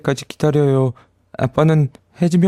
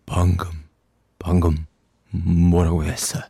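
stunned hush; 8 semitones lower, 4 dB quieter than usual. Acted. Fairy stunned, asks softly.

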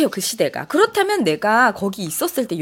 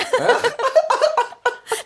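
helpless high-pitched laugh; real, amused cackling; high burst of laughter. Real, not acted. Laughing at the singing.

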